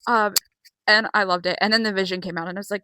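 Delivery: ordinary talk, nothing marked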